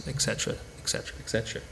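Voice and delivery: rushed, breathy whisper; whispered aside. Real, not acted. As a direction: toss it off under your breath. Trailing list aside.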